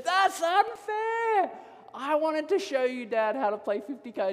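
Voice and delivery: hammed-up child's protest; mock child's wail. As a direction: imitate an outraged little kid, starting very high. Retold child wails at being upstaged.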